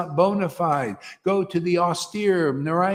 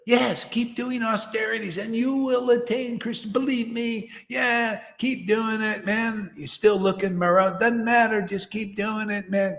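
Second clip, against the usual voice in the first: mock-encouraging guru, higher and pushy; 6 semitones higher than usual. Guru mocked for pushing endless austerities, high and wheedling. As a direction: sell the carrot like a smug guru. Mocking gurus urging austerities.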